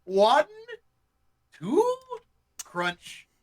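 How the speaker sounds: owl from the commercial, counting bites; lightly voiced cartoon owl counting. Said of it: "counting these off like the owl".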